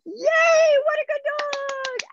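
very high, loud squeaky praise; squeaky happy voice. Shown as a demonstration. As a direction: squeal "Yay, what a good dog" way up high. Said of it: "your best squeaky voice".

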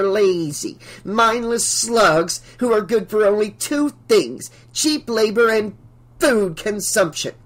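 high-pitched, scornful tirade; indignant shouted rant. A narrator's reading. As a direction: rant contemptuously, dragging out the insults. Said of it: "she shouted".

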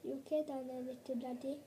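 ordinary delivery

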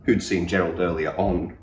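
ordinary delivery

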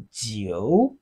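"jiu" dipping low, then rising; third tone. Shown as a demonstration. Teacher models Chinese nine for learners, third-tone "jiu". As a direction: draw out "jiu" from low pitch to a rise. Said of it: "It has the third tone".

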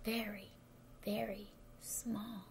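very soft, slowing right down; tiny hushed voice. A narrator's reading. Narrator shows Frog shrinking, tiny and hushed. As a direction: shrink the voice to almost nothing.

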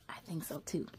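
plain reading voice

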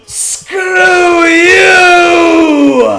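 high, loud furious yell; angry shout. Real, not acted. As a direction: blast it at the game. Yelling at the game.

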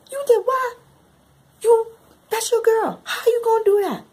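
very high, loud scolding; shocked outrage. Retold or imitated. Reader scolding a woman who betrayed her girl, shocked outrage. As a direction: shriek it in appalled disbelief.

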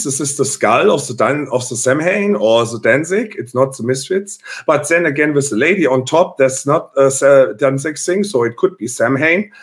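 mock German commenter accent. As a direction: read it out in a put-on German accent. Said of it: "do it in a German accent".

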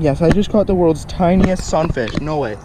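usual speaking voice

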